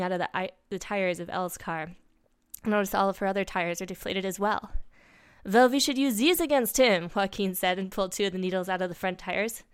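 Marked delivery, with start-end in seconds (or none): 5.5-7.1 put-on higher, louder French-accented voice; lightly acted French accent. A narrator's reading. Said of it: "put-together Frenchman they knew".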